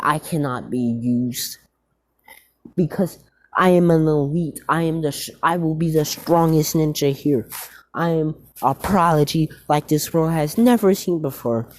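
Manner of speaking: lightly voiced arrogant rival boasting. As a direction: puff up and brag lightly. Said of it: "he has a Vegeta personality".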